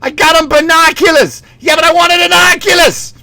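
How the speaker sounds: very high, ranting dad impression; exasperated Boston dad. Dad and son fighting over gift, shrieky mock anger. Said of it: "then the dad's like".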